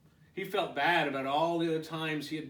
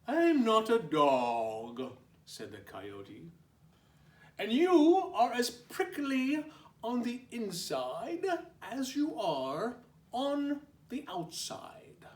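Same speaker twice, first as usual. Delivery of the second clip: 1 coyote voice; 8 semitones higher, 5 dB louder than usual. Acted.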